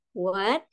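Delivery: "what" rising as in surprise; second-tone demo. Shown as a demonstration. Teacher summing up the tones, rising "what". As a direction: lift the end of "what" like a question. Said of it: "jadi dia naik ke atas".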